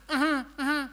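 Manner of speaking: high, mock-frightened grunts; terrified uh-huh. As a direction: imitate someone too scared to look. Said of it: "just like that".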